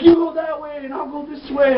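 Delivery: high-pitched imitation of a girl; put-on girl voice. Curly-haired girl splits up from companion, mock urgent.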